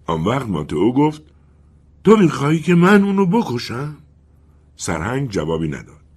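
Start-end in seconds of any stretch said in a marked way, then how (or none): 2.0-4.0 wind's voice. Acted. The wind asks colonel.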